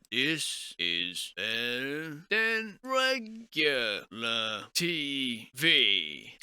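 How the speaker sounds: very slow, drawn-out monster voice; ponderous rock-creature speech. Acted. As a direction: lumber through it word by word. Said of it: "big old rock monster looking on".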